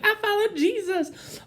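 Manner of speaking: high, slow, chirpy mock piety; mock cheerful. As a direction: mimic a shallow cross-wearer sweetly. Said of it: "to be like".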